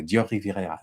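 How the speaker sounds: 'Dior Riviera' said with French rolled R's in a light French accent; French R's through 'Dior Riviera', self-conscious; dodgy French accent. Shown as a demonstration. Vlogger trying the perfume name the French way, a dodgy French accent. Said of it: "My French accent is dodgy".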